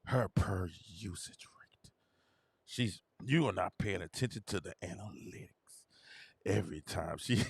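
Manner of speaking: hushed know-it-all whisper; smug fan whisper. Retold or imitated. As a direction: whisper it like a secret stat. Imitating fans' smug whisper.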